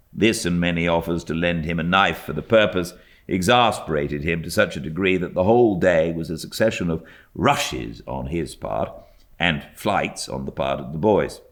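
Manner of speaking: usual speaking voice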